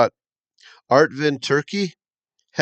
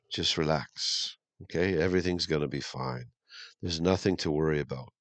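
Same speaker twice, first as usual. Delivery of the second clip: soothing reassurance; 5 semitones lower, 8 dB quieter than usual. Real, not acted. Soothing viewers after bad news.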